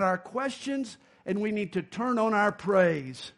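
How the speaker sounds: loud, high full-force preaching; fiery sermon delivery. Acted. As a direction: preach it out to the whole congregation.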